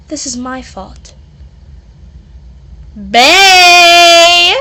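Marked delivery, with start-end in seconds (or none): 2.9-4.5 a big, high yelling call of the name; loud call. Acted. Friend calling out to a fallen companion, loud call. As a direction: shout the name across the room.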